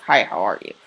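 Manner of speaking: low, subdued greeting; low-key greeting. Shown as a demonstration. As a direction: say hello low and matter-of-fact. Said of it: "Did I say it like".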